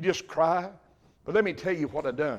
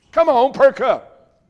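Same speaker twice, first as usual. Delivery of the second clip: rousing call; 9 semitones higher, 13 dB louder, slower than usual. Real, not acted. Rousing the congregation.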